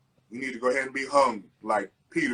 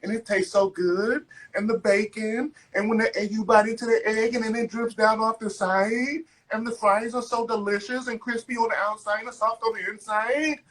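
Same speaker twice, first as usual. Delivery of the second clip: higher gushing mock host voice; food show host; 8 semitones higher than usual. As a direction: parody a TV host swooning over food. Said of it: "some female was on the goddamn thing describing the food".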